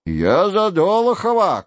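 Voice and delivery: mild storybook yell; acted shout. A narrator's reading. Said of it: "кричал третий".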